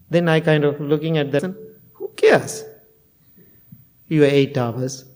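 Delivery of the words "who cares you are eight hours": dismissive retort. Retold or imitated. Monk scoffing at a student's boast, dismissive. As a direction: brush the complaint off with a scoff. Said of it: "That's what I said".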